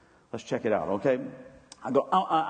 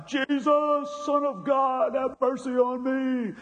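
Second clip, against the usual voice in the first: shouting blind beggar; 10 semitones higher than usual. Retold or imitated. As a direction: cry out to Jesus at the top of the voice. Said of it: "this dude just starts shouting".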